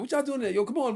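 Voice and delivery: playful high-pitched call-out; teasing girls' call. Retold or imitated. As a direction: block girls calling to the van, mock high voice. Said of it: "all the girls on the block like".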